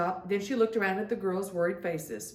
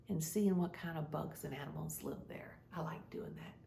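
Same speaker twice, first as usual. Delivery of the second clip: soft unvoiced whisper; 3 semitones lower, 11 dB quieter than usual. Real, not acted. Storyteller whispers a cozy secret to the kids. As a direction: breathe it like a secret.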